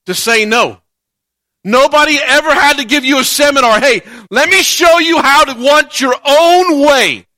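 loud, impassioned preaching. Acted. Pastor preaching to his church, impassioned and loud. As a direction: preach it hard to the congregation.

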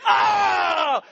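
a high, loud yelp of pain; pained cry. Retold or imitated. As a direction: yelp as your hand smacks the fan. Hand hits the ceiling fan.

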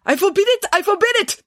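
high, loud outraged declaration; furious high-pitched protest; full-blown rage. Acted. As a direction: explode with indignation as an accented director. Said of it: "working himself up into a full-blown rage".

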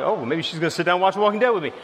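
fast, hopeful mutter to himself; hopeful aside. Retold or imitated. Husband hoping his wife will watch with him, quick and hopeful. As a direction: rush it out like wishful thinking. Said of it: "I'm like".

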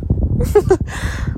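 unvoiced high giggle; high laugh. Real, not acted. Girl laughing at how cringe no sounds, high giggle. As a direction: snicker at the cringe.

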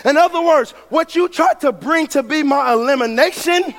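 loud, high, shouted sermon climax; preaching at full force. Acted. Preacher drives the sermon's point home, full shouting fervor. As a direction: hammer each line home to the church.

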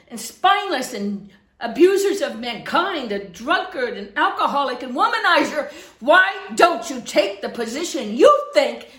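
loud, scolding challenge to the congregation; heated pulpit challenge; fiery preaching. Real, not acted. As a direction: preach a forceful rebuke, punching key words. Preaching at full force.

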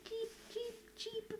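high, soft chirping cheeps; cricket chirps. Pretend. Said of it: "Put crickets in this bit".

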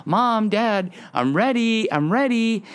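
put-on high, repeated singsong kid call; imitating daughter's insistent calling. Daughter calling Mom and Dad nonstop, imitated high yell. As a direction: keep calling for your parents, high and unrelenting. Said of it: "she'll just keep going".

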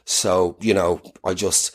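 mock office chat. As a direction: wriggle out of the job politely. HR scene dialogue continues.

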